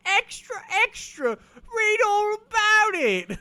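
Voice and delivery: very high, loud, slow newsboy cry; British newsboy. Pretend. Mocking British accents as a loud, high newsboy. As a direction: call it out like a London paperboy. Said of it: "a British accent like that".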